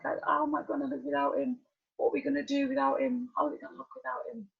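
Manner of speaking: a hint of weeping, half-voiced; retold sobbing plea. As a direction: mildly voice the despair of crying over the dog. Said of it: "bawling my eyes out".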